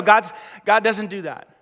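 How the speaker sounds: ordinary talk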